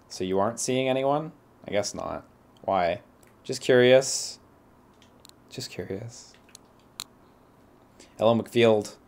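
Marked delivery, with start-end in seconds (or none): 3.4-4.4 blurted out. Acted. Blurted reply, louder. 5.5-6.3 soft aside. Acted. Repeated softly to self.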